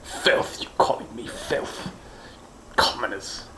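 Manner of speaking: hissed indignant whisper; offended whisper. Acted. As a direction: whisper it in outraged disbelief.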